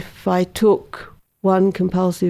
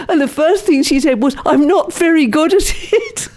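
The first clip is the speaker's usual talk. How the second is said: much higher, helpless with amusement; laughing while telling; 8 semitones higher than usual. Real, not acted. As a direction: tell the punchline through real laughter. Laughs telling nun's confession.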